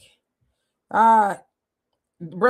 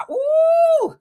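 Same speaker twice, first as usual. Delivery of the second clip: high shouted ooh of disbelief; outraged whoop; 5 dB louder than usual. Real, not acted. Host reacting to reparations news with a shouted ooh.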